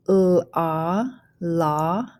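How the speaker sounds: "la" built from l and a long ah; teacher blending "la" slowly; phonics blending. Shown as a demonstration. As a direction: sound out l, ah, then join as "la". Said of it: "go ahead and start blending".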